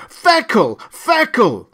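loud, high final cries; screamed slogan. A narrator's reading. Loudspeakers ending the freezer rant, screaming. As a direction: scream the brand name twice. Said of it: "It screamed".

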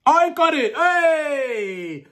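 high, loud triumphant cheer; real burst of delight; excited cheer. Real, not acted. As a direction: celebrate with a drawn-out high shout. Celebrating Siri understanding the accent.

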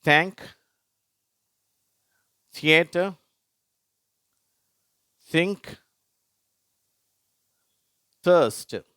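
teacher reading a word list; th word demo. Shown as a demonstration. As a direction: model each word with pauses for repeating. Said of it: "அதை நான் வாசிக்கிறேன்".

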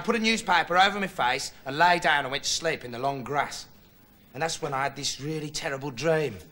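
normal talking voice

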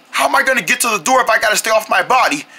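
ordinary talk

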